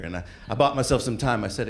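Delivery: ordinary talk, nothing marked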